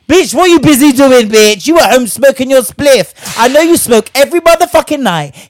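loud high scolding tirade; outraged call-out of a lazy man; furious rant. Real, not acted. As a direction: tear into him, loud and high. Ranting at the ghosting man.

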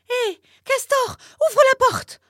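very high, loud shout; turtle shouting. A narrator's reading. Turtle yells at the beaver to open the door, very high and loud. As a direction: yell urgently for him to open up. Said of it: "cria encore la tortue".

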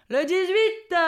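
high, projected number announcement; bingo caller. Retold or imitated. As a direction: announce it to the whole hall. Monsieur Loyal announces the number. (annonce claironnée de monsieur Loyal)

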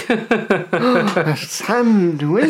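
"A sandwich?" stretched very slowly in fake amazement; mock disbelief. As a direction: drag it out as if it were absurd. Mocking the menu's wording.

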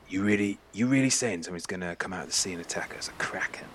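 soft, breathy, hurried questioning; incredulous undertone. Acted. Bjorn questioning a wild sea-monster claim, hushed and incredulous. Bjorn doubts the kraken story.

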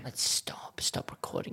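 unvoiced whisper. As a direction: breathe it out in a whisper. Quitting the podcast, whispered.

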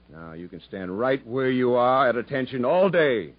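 usual speaking voice

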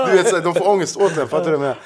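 usual speaking voice

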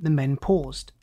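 plain reading voice

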